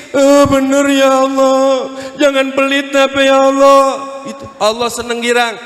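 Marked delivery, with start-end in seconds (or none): 0.1-3.8 mock crying prayer. Shown as a demonstration. Preacher showing husband and wife weeping for a car, mock wailing. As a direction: beg God in hammed-up tears. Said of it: "istri nangis, Pak nangis".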